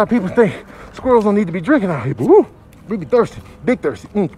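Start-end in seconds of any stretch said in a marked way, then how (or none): none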